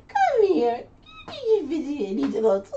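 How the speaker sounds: high, loud sing-song call to a pet; high-pitched dog call. Retold or imitated. Voicing commands to the dog.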